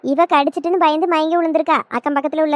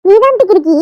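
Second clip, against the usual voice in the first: louder comeback, stress on தான்; retort; 2 semitones higher, 7 dB louder than usual. Acted. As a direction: throw the insult right back with தான் hit hard. Insult thrown back. (தான் அழுத்தி திருப்பி அடிக்கும் பதிலடி)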